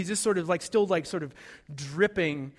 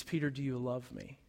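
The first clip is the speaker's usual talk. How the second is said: tender question; 5 semitones lower, 8 dB quieter than usual. Acted. Jesus lifts Peter's head, asks.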